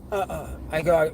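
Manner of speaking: usual speaking voice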